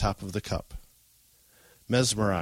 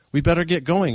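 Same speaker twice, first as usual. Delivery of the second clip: high, loud, lightly muffled words; mouth full; 8 semitones higher, 7 dB louder than usual. A narrator's reading. Boy hurrying off over breakfast, mouth lightly full. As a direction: talk round a bite of food. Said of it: "he said with a mouthful of cereal".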